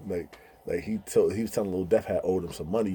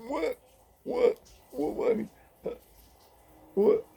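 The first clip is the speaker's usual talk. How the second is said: high puzzled deaf voice; high confused deaf voice asking again and again; deaf friend voice; slower than usual. Retold or imitated. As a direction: ask high and lost.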